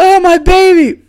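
high, loud, drawn-out imitation wail; mock scream. Lady screaming for her little dog, exaggerated shriek. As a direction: shriek like a distraught pet owner, hammed up. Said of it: "Lady screamed".

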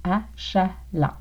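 "Achalant" drawn out slowly as a-cha-lant; teacher's slow model of "Achalant", a-cha-lant; syllable by syllable. Shown as a demonstration. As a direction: break "Achalant" into three slow, separate syllables. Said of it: "Nous utilisons également le mot achalant".

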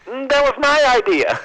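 loud, very high punch line; high mock confession. Storyteller as the player owning up to the victim, high-pitched. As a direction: own up in a comic high voice.

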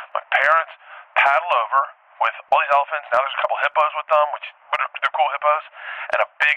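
ordinary talk

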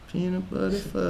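quietly muttering the title to himself; muttered quote. Retold or imitated. Retelling a customer's order, muttered softly. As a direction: drop the voice and murmur it. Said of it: "I'm just like".

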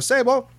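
"Sabo" run together as one quick, high word; clipped passing greeting. Shown as a demonstration. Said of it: "I cut that sentence into a quarter".

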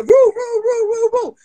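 high-pitched police siren wail; loud mock siren "woo"s; imitated siren. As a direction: whoop like a squad car siren.

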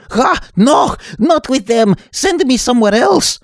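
high, loud frightened pleading; panicked shouting. Acted. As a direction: shout in terror. Terrified refusal to return.

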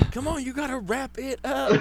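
laughing. Real, not acted. Laughing at the impression.

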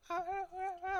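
high muffled voice heard through shower noise; muffled far-off chatter. Retold or imitated. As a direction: mimic unclear talking from another room. Wife talking muffled behind shower.

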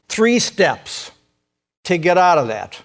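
ordinary talk, nothing marked

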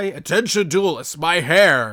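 lightly voiced booming announcer; a hint of a grand tournament announcement; announcer parody. Old running joke, mock announcer calling duelists. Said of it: "the thing that we used to do".

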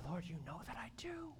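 broken whisper, barely voiced; tearful whisper. Preacher whispering his surrender to the Lord.

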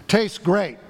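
loud, high call into the microphone; really loud voice. Retold or imitated. Chaplain calling out to two thousand students, loud. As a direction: belt it out to the whole room. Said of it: "he said in a really loud voice".

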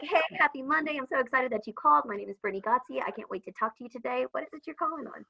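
cheery receptionist thrilled you called; excited phone greeting. Shown as a demonstration. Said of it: "we're like".

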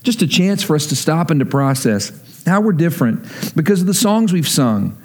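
ordinary talk, nothing marked

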